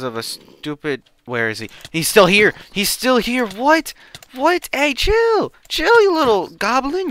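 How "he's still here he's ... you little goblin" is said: high loud shocked yells; loud high-pitched outrage; shocked shouting. Real, not acted. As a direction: yell in real disbelief at the enemy. Shocked enemy still alive.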